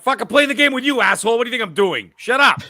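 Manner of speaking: mock-angry retort at a talkative teammate; annoyed snap. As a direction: tell the chatterbox off hard. Snapping at chatty co-op player.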